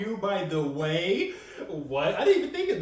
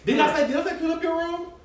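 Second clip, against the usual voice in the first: high mock dad; 8 semitones higher than usual. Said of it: "and I'm like".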